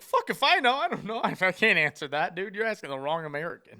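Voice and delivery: high, loud, laughing as it comes out; half-laughing shrug of a reply. Retold or imitated. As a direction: blurt it out through laughter. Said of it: "I just started laughing".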